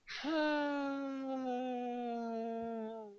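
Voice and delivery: long, drawn-out mock sigh; huge exaggerated sigh. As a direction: imitate a friend's enormous dejected sigh. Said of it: "sighs like the biggest fucking".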